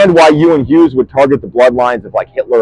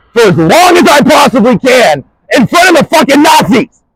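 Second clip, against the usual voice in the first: raised, furious threat; real anger breaking into a shout; angry shout; 6 semitones higher than usual. Real, not acted. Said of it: "I am fucking angry".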